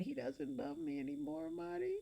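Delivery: high, soft tearful whimper; crying. Acted. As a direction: whimper it through tears. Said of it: "sat on the bed crying".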